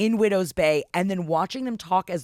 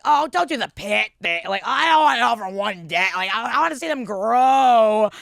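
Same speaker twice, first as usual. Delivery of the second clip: raised, loud pleading complaint; mock protest; 5 semitones higher, 7 dB louder than usual. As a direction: plead in hammed-up dismay. Acting out own first protest.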